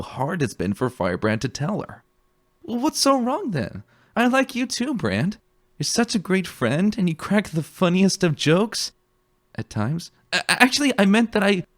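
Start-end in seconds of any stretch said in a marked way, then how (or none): 2.6-10.1 a much higher, bright and breezy mare; laughing it off, chirpy and light; cheerful scout. A narrator's reading. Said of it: "found herself laughing". 10.3-11.6 stumbling over it with a nervous smile; nervous restating. A narrator's reading. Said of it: "he quickly restated, a nervous smile".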